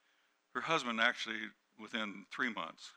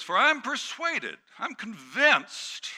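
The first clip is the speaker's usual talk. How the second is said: high, loud, drawn-out declaration; slow, ringing scripture climax; impassioned scripture preaching; 13 semitones higher, 10 dB louder, slower than usual. Acted. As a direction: proclaim the verse with conviction.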